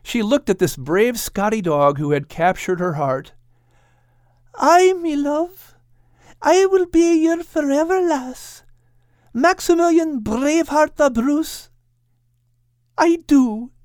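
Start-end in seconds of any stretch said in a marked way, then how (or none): 4.5-13.7 overjoyed little dog saying yes; Kate accepting. Acted. Kate answering Max's proposal, high tender joyful voice.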